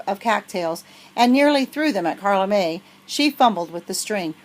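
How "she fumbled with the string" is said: ordinary delivery, nothing marked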